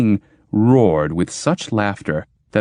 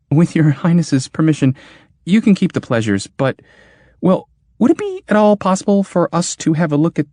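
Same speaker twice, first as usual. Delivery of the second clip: higher, careful hesitant voice; nervous request; 7 semitones higher than usual. Acted. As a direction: ask timidly, step by step. Churl asks nervously.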